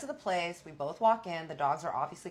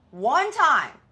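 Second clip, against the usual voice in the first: much higher, louder outburst; exasperated shout; 10 semitones higher, 16 dB louder than usual. Real, not acted. Telling viewers she missed her pill only once, exasperated shout. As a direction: yell it in disbelief. Disbelief at missing one pill.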